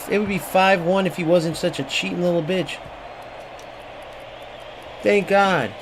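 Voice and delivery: ordinary delivery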